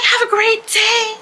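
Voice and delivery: very high, drawn-out, loud send-off; sing-song customer-service sweetness, laid on thick; extra-friendly. Retold or imitated. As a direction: overdo the cheery goodbye, slow and high. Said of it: "I just got extra".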